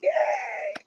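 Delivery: very high drawn-out squealed yay; excited scream. Pretend. Host answering her own call for screaming, excited squeal. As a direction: squeal it as a cheering fan would. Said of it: "someone screaming excitement".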